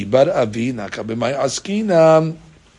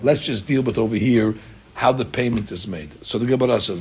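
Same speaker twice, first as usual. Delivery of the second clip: usual speaking voice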